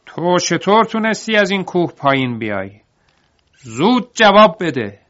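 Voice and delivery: high, loud angry demand, slowing at the end; furious shout. Acted. Trembling master demanding how Davar escaped, high furious shout. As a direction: shout in fear and rage. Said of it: "فریاد زد".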